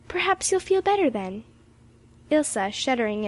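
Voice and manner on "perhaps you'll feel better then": high, coaxing reassurance; urging. A narrator's reading. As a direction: coax the invalid, pitched up. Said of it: "urged Emily".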